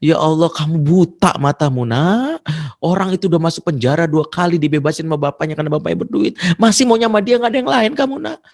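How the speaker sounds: high-pitched pleading rebuke; exasperated mother scolding. Acted. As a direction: scold a stubborn daughter, pitch climbing. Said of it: "Saya udah nasihatin berkali-kali".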